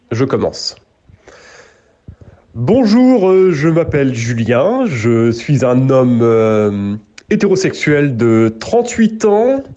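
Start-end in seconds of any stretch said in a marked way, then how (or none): 2.5-9.6 French accent. Shown as a demonstration. Québécois host proves to a friend he can do it, exaggerated French accent. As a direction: lay on a sing-song caricature of a French accent. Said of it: "que je puisse faire l'accent français".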